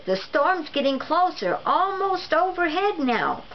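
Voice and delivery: mild mock shout, pitched high; a lightly voiced husband shouting over imagined thunder. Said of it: "Mister Burbank shouted".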